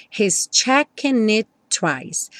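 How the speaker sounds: slow, "checking it" joined, one T; linked checking it. Shown as a demonstration. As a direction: link N to "it", one T before "twice". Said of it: "o checking juntou com it pelo N". ("checking it" ligado, um só T)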